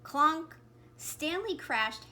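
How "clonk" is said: loud, higher crash word; loud narrated crash; sound effect. A narrator's reading. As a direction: bang it out like a bump.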